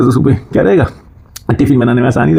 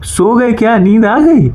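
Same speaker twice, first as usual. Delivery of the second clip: mock wife; 7 semitones higher, slower than usual. Wife checking whether he is asleep.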